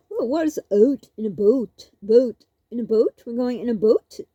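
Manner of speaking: "boat" said "pot" with a clipped o, rising; mocking "boat". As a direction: tease back his Canadian vowels. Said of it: "I'd come back at him".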